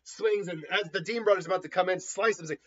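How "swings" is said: plain reading voice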